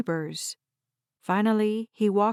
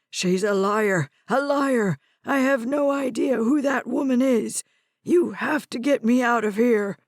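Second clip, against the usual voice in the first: put-on loud accusing old man voice; agitated old man; 3 semitones higher, 4 dB louder than usual. A narrator's reading. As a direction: accuse her, desperate. Confused old man accusing granddaughter.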